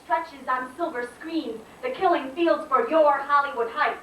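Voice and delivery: high-pitched slam poetry delivery; spoken-word poem. Acted. Poet confronts America about losing her color, declaimed spoken-word indignation. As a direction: declaim it in measured, punched phrases.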